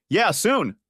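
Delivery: mild yell, pitched high; yelled back. A narrator's reading. Father calling back to his boys, mild narrated yell. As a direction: call back high without really shouting. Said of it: "I yelled back".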